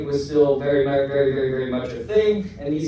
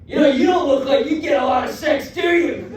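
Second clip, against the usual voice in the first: high, raised-voice challenge; mock confrontational shout; 8 semitones higher, 4 dB louder than usual. Bald stranger sizing him up on the street, loud mock challenge. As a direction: shout the blunt question as the stranger.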